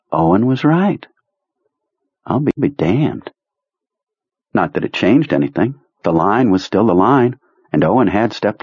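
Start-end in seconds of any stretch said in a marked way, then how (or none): none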